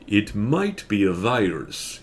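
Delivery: slow, lightly voiced authoritative expert; an expert pronouncing his verdict slowly; announced with authority. A narrator's reading. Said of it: "he announced with authority".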